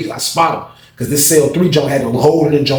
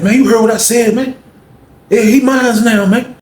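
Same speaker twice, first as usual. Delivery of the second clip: high, louder, claiming voice; possessive taunt; 9 semitones higher, 4 dB louder than usual. Retold or imitated. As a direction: stake your claim, daring him. Cliff claims Paul.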